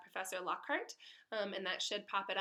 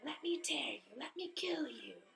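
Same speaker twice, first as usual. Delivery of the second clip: eerie whisper, much softer, far away; ice-cold venom; 2 semitones higher, 6 dB quieter than usual. Acted. Unseen monster calling to Harry, venomous whisper. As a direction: breathe it like a hidden monster. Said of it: "a voice of breathtaking, ice-cold venom".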